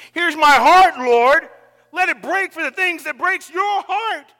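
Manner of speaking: loud, rising plea to the Lord; fervent offering. Acted. Preacher praying his heart will break. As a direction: pour the heart out. Congregation prayer acted out.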